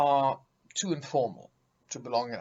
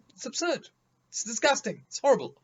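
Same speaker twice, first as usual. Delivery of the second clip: exasperated outrage; 10 semitones higher, 5 dB louder, faster than usual. Real, not acted.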